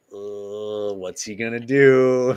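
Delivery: drawn-out imitation of an anxious fan watching; exasperated dread. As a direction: groan it out, building to a worried wail. Acting out fans' dread watching him.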